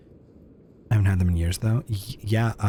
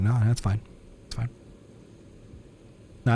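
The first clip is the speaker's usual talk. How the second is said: usual speaking voice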